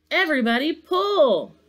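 high, loud command; rally the team with a shout; calling out. A narrator's reading. Santa orders his stuck team, loud high call.

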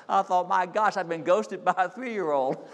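laughing through the words, slowly; chuckling at himself as he tells it. Real, not acted. Pastor laughing at being dropped by his toddler.

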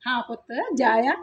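soft, low, tender assent; indulgent. Acted. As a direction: gently give a favourite your blessing. Indulges favoured daughter-in-law.

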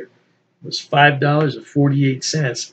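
ordinary delivery

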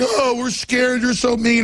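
mock the media as crybabies; sarcastic whimper of pretend fear; mocking whiny voice.

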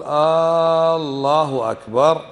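"الله أكبر" with the opening A held very long, "Aaallahu akbar"; lengthened first vowel. Shown as a demonstration. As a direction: stretch the first vowel of "الله" into a question word. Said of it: "من خطأ أن تقول".

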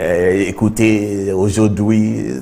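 very slow, halting French words; mock clumsy French attempt; stumbling schoolboy French. Shown as a demonstration. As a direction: drag out the French like you never learned it. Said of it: "We cannot say".

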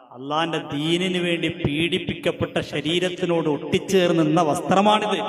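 thundering sermon voice; fiery preaching. Acted. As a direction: build to a roaring pulpit climax. Preaches the imam's words.

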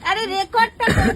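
shouts across to be heard; loud call. Real, not acted. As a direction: call out loudly so someone hears. Calling out to get it recorded. (চেঁচিয়ে ডাকা)

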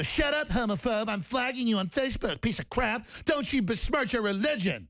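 high, whiny mock accuser; mocking liberal quote. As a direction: parody a scolding leftist. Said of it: "they go".

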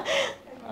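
bright burst of high laughter. Real, not acted. Laughs at her own joke.

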